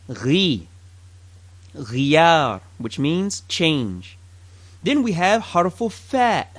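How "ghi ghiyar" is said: "ghi" and "Ghiyar" low and gargled; ghain demo. Shown as a demonstration. Teacher models ghain in the word for change.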